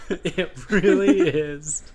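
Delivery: laughing while agreeing. Real, not acted. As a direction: laugh through the words.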